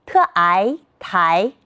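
"特哀台" slowly, "哀" and "台" rising as ái, tái; slow second-tone blend "特哀台"; pinyin blending. Shown as a demonstration. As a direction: sound out "特哀", then glide up on "台". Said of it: "自己也来读读吧".

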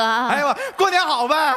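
high-pitched called-out greeting; big New Year shout; loud greeting. Acted. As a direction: holler it across a distance. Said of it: "你稍微小点声".

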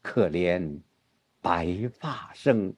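low, soft and very slow; somber turn. Shown as a demonstration. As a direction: pause after 可怜, then sink. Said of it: "很自然的就是". (低沉缓慢，“可怜”后停顿)

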